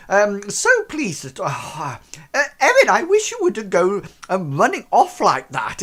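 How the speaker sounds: high, loud, flustered host voice; an overexcited father ushering guests to sit down; fussy posh father. Acted. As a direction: gush over the guests like a fretful aristocrat.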